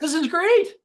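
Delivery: high, gleeful squeal; thrilled, a bit hammed-up; mock delight. Retold delight at freedom.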